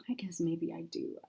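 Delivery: small defeated whisper. Acted. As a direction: breathe it out softly. Unvoiced whisper.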